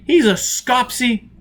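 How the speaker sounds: high, loud, slow question; stunned disbelief. A narrator's reading. Foyle learning of the captain, stunned. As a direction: repeat it in shock. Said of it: "Foyle was thunderstruck".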